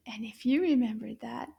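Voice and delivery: ordinary talk, nothing marked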